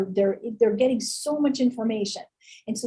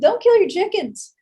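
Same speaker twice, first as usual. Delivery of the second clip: higher, louder Southern twang, leaning on kill; a Savannah friend giving folksy advice in a Southern accent; 9 semitones higher, 5 dB louder than usual. Retold or imitated. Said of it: "in her Southern accent".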